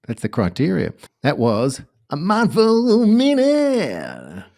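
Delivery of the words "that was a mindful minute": high, slow hammed-up rock star voice.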